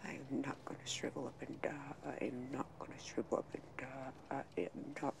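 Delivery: wife vowing to herself after the threat, soft and fast; whispered vow. Acted. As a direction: mutter it to yourself, ending in a whisper.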